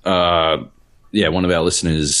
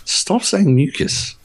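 slow, high-pitched mock protest; exasperated; 12 semitones higher, slower than usual. Customer to chemist who keeps asking about mucus, exasperated. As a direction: plead in drawn-out mock exasperation.

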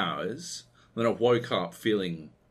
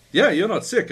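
much higher, louder breezy brush-off; dismissive doctor; 10 semitones higher, 8 dB louder than usual. Retold or imitated. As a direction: wave off the patient brightly. Doctor voiced in a story.

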